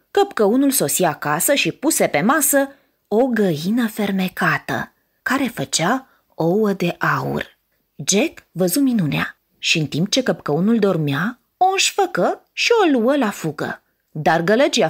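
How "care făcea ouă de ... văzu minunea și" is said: usual speaking voice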